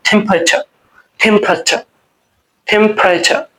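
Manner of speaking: টেম্পারেচার with aspirated "th", ending "cha" with no r; native pronunciation. Shown as a demonstration. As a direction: say টেম্পারেচার like a native speaker, "thempracha". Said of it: "ওরা বলবে এটাকে". (নেটিভদের মতো টেম্পারেচার)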